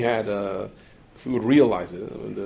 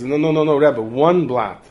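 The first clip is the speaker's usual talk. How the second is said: 1 insistent correction; 3 semitones higher, 8 dB louder, slower than usual. Retold or imitated. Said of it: "He says".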